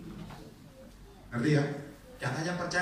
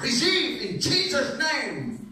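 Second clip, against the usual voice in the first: much higher, very slow intoning; foreign preacher; 11 semitones higher, 6 dB louder, much slower than usual. Retold or imitated. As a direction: drawl it like a visiting healer. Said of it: "hamba Tuhan dari luar negeri".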